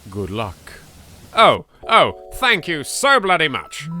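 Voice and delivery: usual speaking voice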